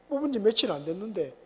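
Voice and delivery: ordinary delivery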